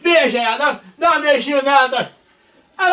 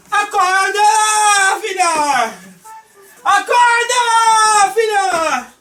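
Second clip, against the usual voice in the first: drawn-out wake-up call; 5 semitones higher, much slower than usual. Acted. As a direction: call her awake like a long alarm. Calling her awake, drawn out.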